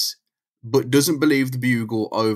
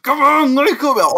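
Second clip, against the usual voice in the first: mock urging; 7 dB louder than usual. Coaxing her in a retold scene.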